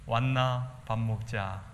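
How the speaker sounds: slow, drawn-out Daegu Gyeongsang dialect. Shown as a demonstration. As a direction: say "왔나 밥 먹자" slowly in the Gyeongsang lilt. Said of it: "대구 말로 하면은". (대구 사투리로 느릿하게 "왔나 밥 먹자")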